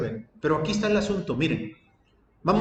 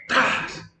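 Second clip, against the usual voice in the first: imitated smash. Preacher acts out husband breaking the phone, imitated crash. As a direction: mimic a phone smashing in one burst.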